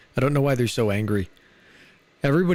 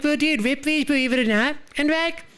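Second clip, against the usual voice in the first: a high, silly put-on voice tossing out the quip; high goofy voice. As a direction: squeak the punchline like a cartoon dude. Quip in the character voice.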